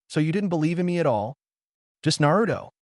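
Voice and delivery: high, fast, hurt outburst; angry. Acted. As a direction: fire it back, furious. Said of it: "Sasuke was absolutely furious".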